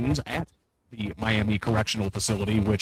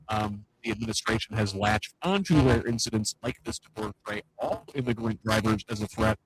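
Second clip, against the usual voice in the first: ordinary delivery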